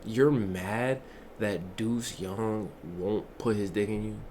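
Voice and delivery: low, soft, deliberate disbelief; quiet incredulity. Acted. Streamer addressing the rejected girl, low quiet incredulity. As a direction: drop low and soft, spacing out each phrase.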